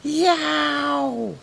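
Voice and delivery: high, loud drawn-out yell; loud yowl. A narrator's reading. Smoking robot lets out a loud howl. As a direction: let out one long high yelp. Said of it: "it let out a loud".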